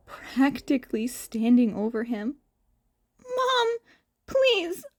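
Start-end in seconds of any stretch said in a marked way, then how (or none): 3.4-4.9 high, small pleading voice; high frightened plea; pleading child. Acted. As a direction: beg your mother to stop.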